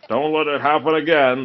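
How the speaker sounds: warning. Acted. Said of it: "the first ogre warned him".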